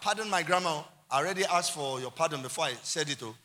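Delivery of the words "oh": ordinary talk, nothing marked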